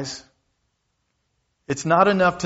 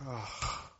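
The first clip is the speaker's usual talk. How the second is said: very soft, breath-only sigh; exasperated sigh; 19 dB quieter than usual. Acted. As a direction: let out a quiet, disappointed exhale. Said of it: "And Jesus goes".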